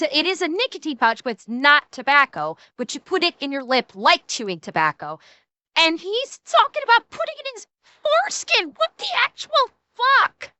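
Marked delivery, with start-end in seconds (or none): 5.8-10.5 high, incredulous outburst; appalled disbelief. Real, not acted. Streamer reacting to a foreskin remark, appalled disbelief.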